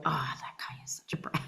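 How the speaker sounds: hushed, unvoiced grumble; whispered aside. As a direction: confide it under your breath. Whispered aside while recording.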